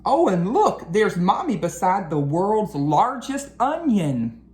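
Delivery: ordinary delivery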